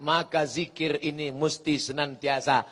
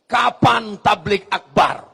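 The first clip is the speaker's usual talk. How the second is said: louder, slow booming question; 3 semitones higher, 10 dB louder, slower than usual. Retold or imitated. Ustadz Somad asks comatose man about the rally. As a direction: boom the question out slowly. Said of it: "Apa yang ditanya Ustadz Somad".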